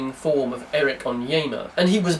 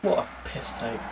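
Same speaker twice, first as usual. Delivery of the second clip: normal talking voice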